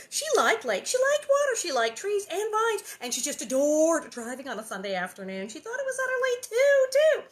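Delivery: high, loud gushing; gushing Nellie. Acted. Nellie gushes about the drive, high and loud.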